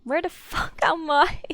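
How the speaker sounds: loud, slow, baffled outburst; suddenly lost and yelling; confused shout. Real, not acted. Lost after falling somewhere.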